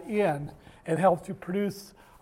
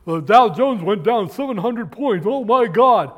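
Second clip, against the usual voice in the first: mock panic; 4 semitones higher, 9 dB louder than usual. Mocking alarmed news hype.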